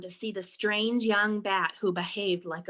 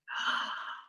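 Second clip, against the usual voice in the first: soft, breathy gasp of suspense; worried gasp; 6 dB quieter than usual. Acted. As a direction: gasp softly as something comes near. Narrator gasps at approaching wings.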